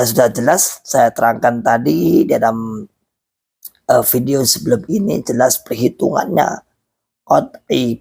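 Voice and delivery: ordinary talk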